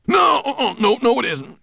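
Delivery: very high, loud dwarf shout; protesting cry. A narrator's reading. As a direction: insist frantically at the top of your voice. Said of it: "cried the dwarf".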